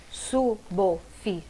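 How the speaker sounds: slow suboficial, su-bo-fi-ci-al with pauses; syllable by syllable. Shown as a demonstration. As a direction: split suboficial into five separate syllables. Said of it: "como separa".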